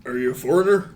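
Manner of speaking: bartender voice. Acted.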